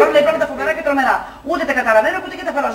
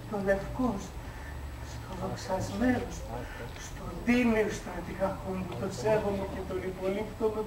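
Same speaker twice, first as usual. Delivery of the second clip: soft mock devotion; 4 semitones lower, 12 dB quieter than usual. Drunk flattering the general.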